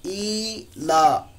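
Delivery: ई ला very slow, both long vowels held; stretched vowels. Shown as a demonstration. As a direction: hold the "ee" and "aa" of ई ला. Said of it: "हम खींच कर पढ़ते हैं". (ई ला, दोनों हरूफ खींचकर)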